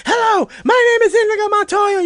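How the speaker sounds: high, loud desperate yell; screaming. Retold or imitated. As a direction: scream it with the last strength. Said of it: "he just starts screaming it".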